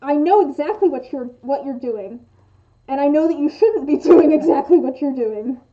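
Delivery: loud, accusing demo scolding; mock anger. Acting out what the question implies, mock anger. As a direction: play an angry telling-off, breaking into a laugh. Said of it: "like, anger, I feel like".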